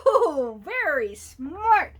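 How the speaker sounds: usual speaking voice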